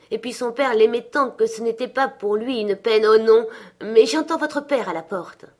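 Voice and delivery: warmly, then suddenly alert to the door. A narrator's reading. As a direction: she hears father coming. Mother, then hearing father.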